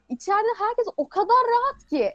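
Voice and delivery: a hint of an awed girl backstage; starstruck girl voice. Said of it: "Ben kulise girmiş kız taklidi yaptım".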